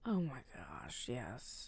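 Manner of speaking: whispered, creeped-out agreement; hushed whisper. Real, not acted. Friends whisper about creepy strangers, hushed. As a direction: drop to a shocked whisper.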